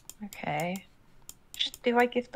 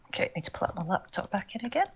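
whispering; 2 semitones lower, 7 dB quieter, faster than usual. Real, not acted. Muttering to self.

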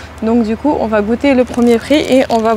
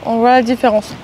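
chewing between words; eating while talking; mouth partly full. Real, not acted. Seen 0: Bit onigiri just before; mouth moves chewing-like while speaking, food held.

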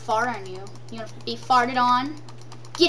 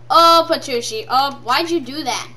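ordinary delivery

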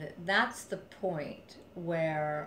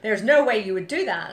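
ordinary delivery

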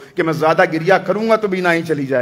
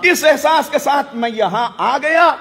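preaching at full force; 6 semitones higher than usual. Real, not acted. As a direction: cry out the devotion of arriving at the shrine. Preacher rising to a climax.

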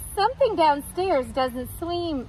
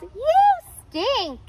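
high mock yell; 10 semitones higher than usual. A narrator's reading. Tuna fish yelling at the garlic, high taunt. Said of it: "they yelled".

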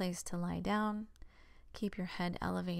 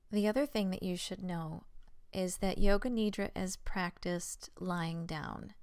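normal talking voice

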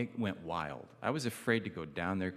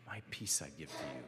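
much softer, quick and hushed; hushed tender quote; 8 semitones lower, 12 dB quieter, faster than usual. Retold or imitated. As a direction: hand over the words in a hushed rush. Jesus quoted to betraying disciples.

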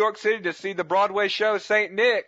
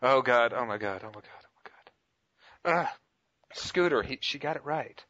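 dismayed; 6 semitones lower, 5 dB quieter, faster than usual. Real, not acted. Host dismayed, she won.

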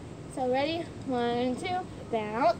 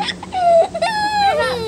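shout; 12 semitones higher, 8 dB louder than usual. Real, not acted. Yells as the stunt collapses.

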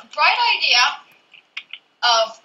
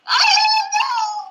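high squeal; slower than usual. Pretend. Squealing joke while gaming.

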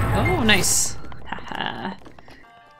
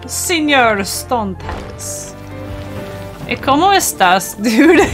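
mock Spanish accent; 3 semitones higher, 4 dB louder, slower than usual. Voicing the boss in Spanish.

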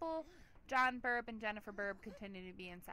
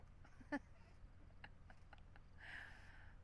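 breathy giggle; 13 dB quieter than usual. Real, not acted. Cracking up at own joke.

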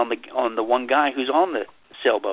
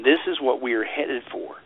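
ordinary talk

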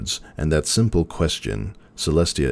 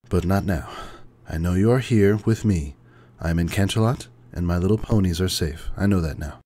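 higher, gentle princess voice; Celestia's voice; 5 semitones higher than usual. A narrator's reading. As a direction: speak as an ancient, weary princess.

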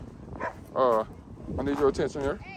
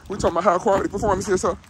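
hyped pitch; 5 semitones higher, 5 dB louder, faster than usual. Real, not acted. Hyping the dog's performance.

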